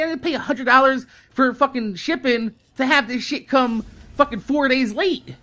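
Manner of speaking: ordinary talk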